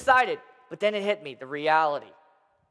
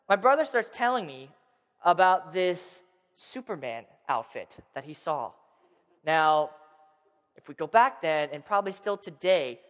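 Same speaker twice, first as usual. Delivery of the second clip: usual speaking voice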